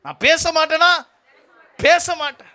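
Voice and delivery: high, loud, defiant mock refusal; hammed-up obstinate shout; stubborn refusal. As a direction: imitate someone digging their heels in. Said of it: "வைராக்கியம்".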